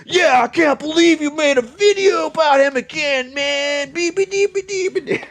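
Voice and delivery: mocking viewers cheering the feud; hyped viewer. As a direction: squeal along like an eager fan. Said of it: "people watch it like".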